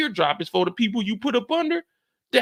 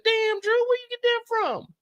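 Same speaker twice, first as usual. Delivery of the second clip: high, surprised yelp; shocked; 13 semitones higher than usual. Retold or imitated. Host catches little Drew with a baggie, high shocked voice. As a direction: squeak it out in disbelief.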